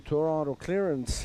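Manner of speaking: ordinary talk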